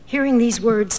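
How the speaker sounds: ordinary delivery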